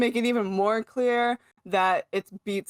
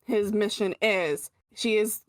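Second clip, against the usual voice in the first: normal talking voice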